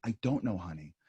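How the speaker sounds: soft, low, tender "I don't know, honey"; gentle admission. Retold or imitated. Parent gently telling a child they don't have the answer, tender. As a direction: say it softly to a child, letting go. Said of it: "and even to say".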